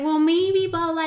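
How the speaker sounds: sing-song mimicry of a forgiving friend; lightly mimicking a gentle friend. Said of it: "she'll be like".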